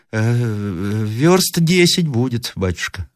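slow, high granny voice; hesitate, then draw out the answer; old woman. A narrator's reading. Old woman tells traveller the distance, slow granny voice.

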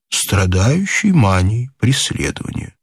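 plain reading voice

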